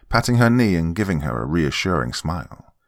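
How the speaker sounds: ordinary delivery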